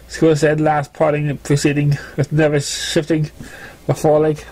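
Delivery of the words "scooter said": plain reading voice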